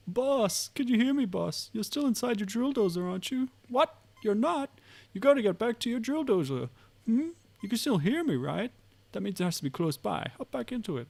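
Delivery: put-on high, loud old man calling; a frantic old geezer on the radio. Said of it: "That was my old man voice".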